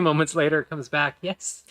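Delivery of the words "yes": soft, higher questioning yes; half-elf server. Acted. Half-elf server returns to the table, soft higher voice. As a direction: answer a customer's call, softly and higher. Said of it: "she pivots around".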